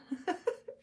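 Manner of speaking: short high giggle after the joke. Real, not acted. Giggling at the police-check story, high laugh. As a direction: giggle at your own punchline.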